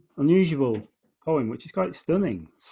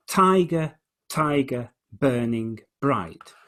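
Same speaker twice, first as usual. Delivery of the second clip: "tiger" stressed on "ti", slow "burning bright"; trochaic stress; slower than usual. Shown as a demonstration. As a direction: lean on the first beat of "tiger". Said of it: "the trochaic".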